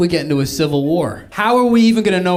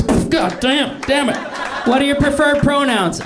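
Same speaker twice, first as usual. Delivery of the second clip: high-pitched frustrated questioner; exasperated far left guy; 11 semitones higher than usual. Acted. Far left guy quizzing his double, high and exasperated. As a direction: pitch it way up, fed up.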